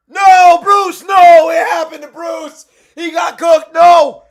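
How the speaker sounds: loud, high anguished yelling; real shout of dismay. Real, not acted. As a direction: yell at the screen in real disbelief. Watching Bruce Buffer's blunder.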